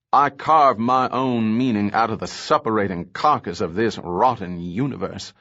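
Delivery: ordinary delivery, nothing marked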